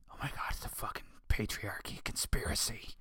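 hushed, conspiratorial mock whisper; sarcastic whispered aside. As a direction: whisper it like a scandalous secret.

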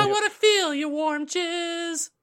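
high, slow, crooning falsetto; soulful falsetto. Pretend. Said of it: "I like my falsetto".

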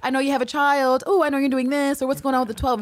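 high, mock-intrigued oohing; teasing provocation. Villanelle messing with people by dropping secrets, high taunting. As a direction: dangle secrets to needle someone. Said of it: "likes to fuck with people".

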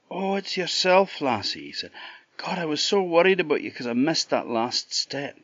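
high-pitched, worried relief; lightly voiced, gushing concern; acted relief. Chuck greets the lassie after missing the step, relieved.

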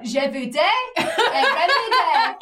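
very slow, drawn out, through laughter; laughing botched French. Retold or imitated. Botching the phrase to her brother: slow, laughing mispronunciation. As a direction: stretch out the garbled French giggling. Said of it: "I was like".

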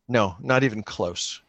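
normal talking voice

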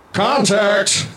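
sudden high-pitched battle shout; Rusty. Acted. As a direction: call it out like a gunfighter mid-fight. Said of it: "he just shouts".

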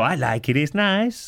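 mock-foreign accent rising high, laughing; Borat. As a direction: mimic a cheerful foreigner, lifting the last words. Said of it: "he talked like Borat".

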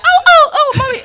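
very high, loud mock squeals; imitated panicking son. Son calling his mother over a fly, imitated panic. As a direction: shriek like a scared child, hammed up. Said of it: "now my son be like".

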